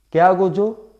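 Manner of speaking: ordinary talk, nothing marked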